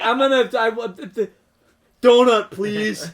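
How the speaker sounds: stumble, then blurt it out like a clueless American; dumb American. Acted. Tourist failing to order breakfast in German, dumb American. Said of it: "Dumb American".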